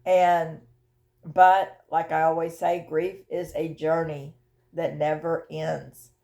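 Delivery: usual speaking voice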